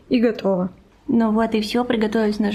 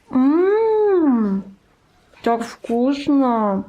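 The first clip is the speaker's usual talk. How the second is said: savouring; 2 semitones higher, much slower than usual. Real, not acted. Savouring the taste.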